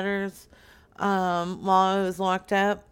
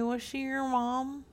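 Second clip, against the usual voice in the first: softer, higher-pitched gentle question; soft-spoken; 4 semitones higher, 5 dB quieter than usual. Pretend. Caller asking the bereaved man about Brenda, soft-spoken. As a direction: ask it delicately, volume held back. First ask, not heard.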